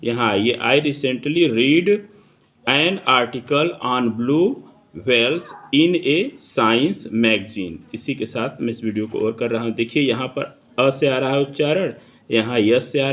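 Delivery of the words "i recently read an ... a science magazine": slow, deliberate example sentence read; dictation reading. Shown as a demonstration. Teacher reading an example sentence to students, slow and deliberate. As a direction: read it out slowly, word by word for the class.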